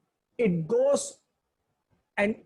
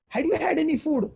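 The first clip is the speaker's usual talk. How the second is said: healthy dog; 7 semitones higher, faster than usual. Retold or imitated. Healthy dog questions hungry dog.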